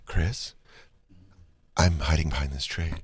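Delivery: soft, low mock-creepy fan voice; timid fan. Gethard's fan calls from behind a tree, hushed imitation. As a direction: call out softly from a hiding place.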